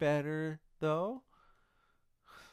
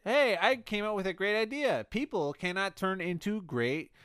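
higher, louder mock boast; proudly pitched-up proclamation; excited announcement; 7 semitones higher, 4 dB louder than usual. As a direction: announce it like a brilliant brainwave. Acting out his proud announcement online.

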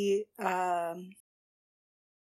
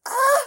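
short, high, loud squeal; delighted squeal; 11 semitones higher, 9 dB louder than usual. Real, not acted. Viewer reacting to that cute face, delighted squeal.

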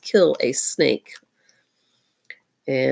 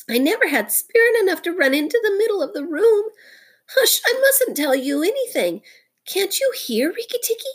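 high, whimpering muskrat voice; sobbing. A narrator's reading. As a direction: plead pitifully, high and fretful. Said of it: "he sobbed".

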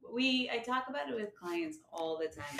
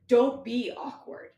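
louder, emphatic self-command; scolding self-talk; 4 semitones higher, 10 dB louder, slower than usual. Acted. Anxious person telling herself off, scolding. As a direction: order yourself sternly to stop.

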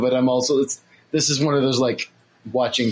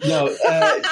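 laughing; 5 semitones higher than usual. Real, not acted. Laughing reply.

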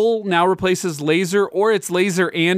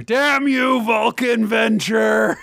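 high, drawn-out mock shout; mock cursing; 7 semitones higher, slower than usual. Cursing the manliest title ever, hammed-up mock shout. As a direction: curse it like a hammy villain.

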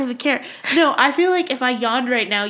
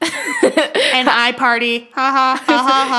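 robotic killer; 3 dB louder, slower than usual. Pretend. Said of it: "And the killer just being like".

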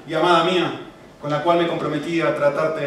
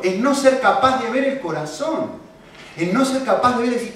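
ordinary talk, nothing marked

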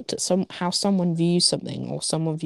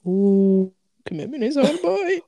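exaggerated realization; 6 semitones higher, 6 dB louder, slower than usual. Acted realization quote.

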